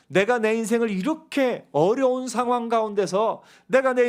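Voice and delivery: mock demand of a struggling believer; demanding of God. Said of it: "따져 물을 때가 있습니다".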